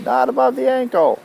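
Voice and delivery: high, mock shrill yell of a fussy escort; Effie yelling. A narrator's reading. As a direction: squeal it at the clumsy girl. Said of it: "smacking my hands and yelling".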